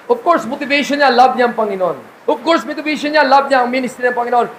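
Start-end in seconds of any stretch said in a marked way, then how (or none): none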